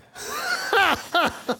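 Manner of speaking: high, delighted laugh; laughing at the punchline. Real, not acted. Laughs at own story.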